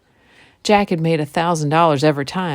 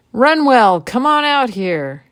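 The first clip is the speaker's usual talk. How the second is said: calling out; 7 semitones higher, 5 dB louder, slower than usual. Acted. Jack calls Runwell from the ship.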